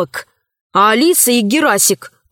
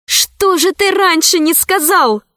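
восклицание; 7 semitones higher than usual. A narrator's reading. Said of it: "воскликнула Алиса".

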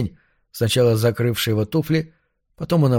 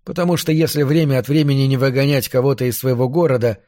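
ordinary delivery

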